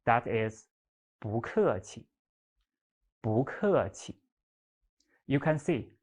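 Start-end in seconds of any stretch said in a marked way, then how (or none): 1.2-4.2 不客气 with rising 不, falling 客, light 气; 不 in second tone. Shown as a demonstration. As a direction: lift 不, drop 客, leave 气 short in 不客气. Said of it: "不 here is also the second tone".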